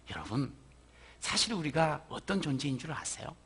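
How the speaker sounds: ordinary talk, nothing marked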